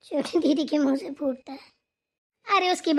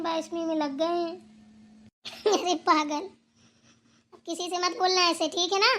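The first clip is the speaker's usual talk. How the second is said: ordinary talk, nothing marked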